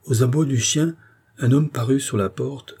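ordinary delivery

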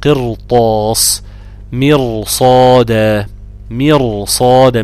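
قرطاس and مرصادة drawn out very slowly, R heavy; slow example words. Shown as a demonstration. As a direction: spell out مرصادة slowly with a thick R. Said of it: "زي كلمة إيه؟".

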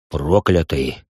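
swallows hard, then speaks low; swallowing mid sentence. A narrator's reading. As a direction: gulp before the word. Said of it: "сглотнув, произнёс парень". (сглотнув, тихо и низко)

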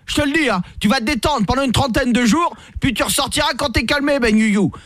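high, hammed-up threats; mock-furious commissioner threatening jail; threatening cop. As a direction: lay down the law like a cartoon cop. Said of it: "Mais je suis calme".

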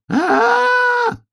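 very high drawn-out wail; long high storybook scream; breaking-voice scream. A narrator's reading. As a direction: shriek "aaah" like a frantic little hedgehog. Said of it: "ёжикиным срывающимся голосом закричала".

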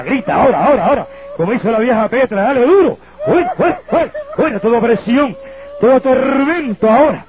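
high, driving revival preaching; preaching at full force. Acted. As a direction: rouse the congregation, chanting and pushing. Liberación gritada a la congregación. (predicación a todo pulmón)

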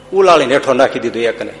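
ordinary delivery, nothing marked

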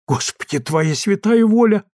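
put-on startled old woman's prayer, rising high; gasped exclamation. A narrator's reading. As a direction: exclaim in alarm. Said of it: "ахнула Марья Семёновна".

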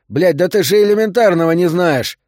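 sudden exasperated outburst; snapping. A narrator's reading. Doctor loses patience with his patient, sudden angry outburst. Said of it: "Сорвался он внезапно".

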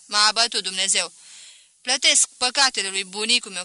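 loud, emphatic lament; outburst. A narrator's reading. Merchant bursts out about his bad luck in marriage, loud lament. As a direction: burst out with your complaint.

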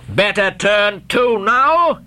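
slow, mocking taunt; heartless jeer. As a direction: sneer it down at them slowly. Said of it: "said the captain with a heartless jeer".

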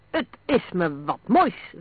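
slow, loud sarcastic outrage; heated, drawn-out complaint; indignant cry. A narrator's reading. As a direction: call out in indignation, stretching the words. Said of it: "riep Ross, die zich probeerde los te rukken".

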